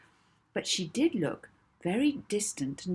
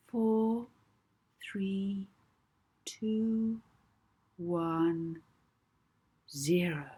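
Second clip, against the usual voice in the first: soothing hypnotist voice; 4 semitones higher than usual. Acted. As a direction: count her down into trance. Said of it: "her most soothing voice".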